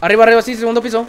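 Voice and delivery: shouted callout. Real, not acted. Calling out enemy position.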